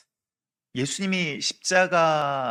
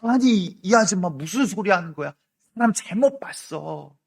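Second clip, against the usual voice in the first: loud, higher, flustered brush-off; jumping denial; 5 semitones higher, 7 dB louder than usual. Retold or imitated. Peter startled by the woman, loudly denying he knows Jesus. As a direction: leap back and deny it hard. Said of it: "펄쩍 뛰면서 이야기하는 거예요".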